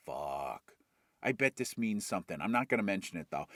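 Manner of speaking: low, soft private mutter; muttered to himself. Acted. Hero musing alone after the vision, low and quiet. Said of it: "he's like".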